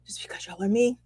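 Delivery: breathy confiding whisper; a private little whisper; whispered aside. Real, not acted. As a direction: lean in and whisper it. Aside to water signs.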